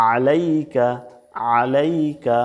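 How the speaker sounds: "আলাইকা" opened with a squeeze from mid-throat; pressed ain. Shown as a demonstration. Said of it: "গলার মাঝখান থেকে চাপ দিয়ে". (গলা চেপে আইন উচ্চারণ)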